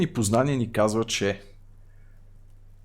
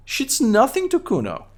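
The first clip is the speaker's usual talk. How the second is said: put-on high-pitched mouthy kid voice; Kuno voice; 12 semitones higher, 3 dB louder than usual. Kid Kuno mocks the cop, high bratty kid voice. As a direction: voice a rude little brat up high, talking about himself in third person. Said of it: "What is wrong with your high voice?".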